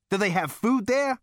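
high, loud query about food; eager question. A narrator's reading. Zaphod asks Rooster about food on Frog Star, eagerly.

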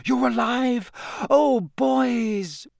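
high, tearful relief; overwhelmed mother. A narrator's reading. Mother seeing her sons alive, high and tearful. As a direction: gasp with relief at the boys.

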